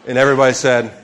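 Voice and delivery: loud, high preacher's call for Amen; loud call and response; rousing call. Real, not acted. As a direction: rouse the room to answer. Preacher calling for congregation response.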